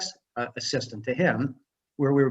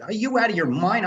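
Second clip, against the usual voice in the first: higher, mock-alarmed outburst; alarmed protest; 8 semitones higher, 3 dB louder than usual. Rookie jumping out of the explosives van, alarmed protest. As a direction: protest as if recalling a scare. Retelling panic at handling explosive.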